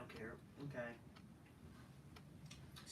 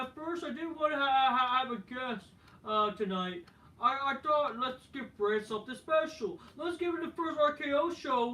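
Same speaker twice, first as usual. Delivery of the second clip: high, louder put-on Kevin Owens voice; imitating Kevin Owens; 8 semitones higher, 3 dB louder than usual. Kevin Owens rambling to the crowd in a high, put-on voice. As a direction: perform him as a rambling host. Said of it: "Kevin Owens says".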